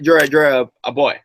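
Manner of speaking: ordinary delivery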